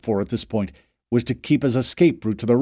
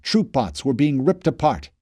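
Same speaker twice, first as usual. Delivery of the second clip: ordinary delivery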